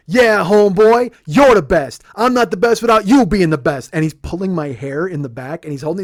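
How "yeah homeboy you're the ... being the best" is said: loud, high, wild-eyed hype shout. Retold or imitated. Rapper hugging a fan hard, fervent loud holler. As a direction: holler praise with full force. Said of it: "And he goes".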